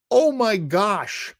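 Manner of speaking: ordinary talk, nothing marked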